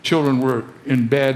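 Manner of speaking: ordinary talk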